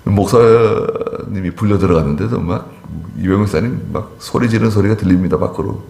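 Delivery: ordinary talk, nothing marked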